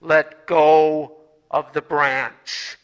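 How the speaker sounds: God calmly instructs the dangling hiker; God's voice. Acted. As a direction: speak slowly like God from the heavens. God's voice answers from above.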